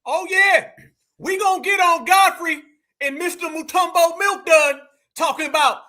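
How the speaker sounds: high and loud Oh yeah, then an excited run; gleeful, ready to go at them; hyped reaction. Real, not acted. Host getting ready to go after Godfrey, excited.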